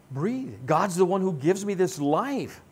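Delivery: ordinary delivery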